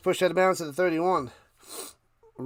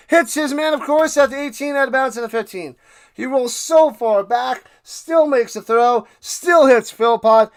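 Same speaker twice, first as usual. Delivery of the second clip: loud, higher, fast broadcast call; excited play-by-play; 6 semitones higher, 8 dB louder than usual. Acted. Streamer calling Alexander's scramble for chat, hyped play-by-play. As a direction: ride the play like a hyped commentator.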